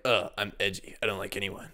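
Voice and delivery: lightly mocked brooding loner; edgy loner. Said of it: "where he's like".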